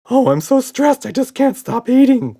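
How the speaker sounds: high, loud anxious whimper; frantic nervous creature wail. A narrator's reading. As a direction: whine it like a panicky little monster. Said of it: "whimpered Red".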